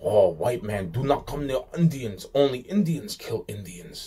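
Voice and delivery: mimicking them with a sneer; mocking put-on voice. Mocking quote of other people.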